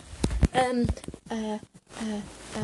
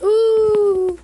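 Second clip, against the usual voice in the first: high, loud whooped "ooh"; giddy "ooh"; 11 semitones higher, 10 dB louder than usual. Real, not acted. Vlogger after a laughing fit, giddy whoop. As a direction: let out a loud, giddy hoot.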